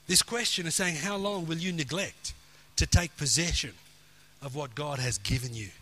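ordinary delivery

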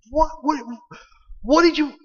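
high-pitched, stammering shock; shocked disbelief. Retold or imitated. Sister hearing about the damaged car, shocked and sputtering.

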